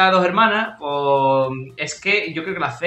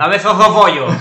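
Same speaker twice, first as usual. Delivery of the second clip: put-on lisping Andalusian accent; 3 semitones higher, 7 dB louder than usual. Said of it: "tú puedes ser alemán".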